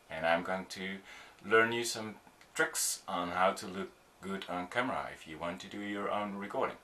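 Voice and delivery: measured self-introduction with clear pauses between phrases. Shown as a demonstration. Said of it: "this would be better".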